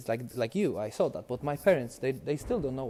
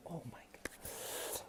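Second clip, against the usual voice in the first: acted-out awe in a slow whisper; hushed amazement; 2 semitones higher, 19 dB quieter, much slower than usual. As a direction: breathe it out slowly, voiceless, as if stunned. Reliving amazement at the pay.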